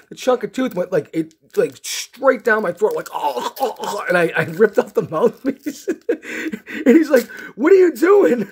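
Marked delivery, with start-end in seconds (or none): 3.1-4.0 high gagging cries; mock choking. Patient with tooth down his throat, mock choking. 7.5-8.5 loud, very high startled question; alarmed. Retold or imitated. Startled dentist to patient ripping out mouthpiece, alarmed.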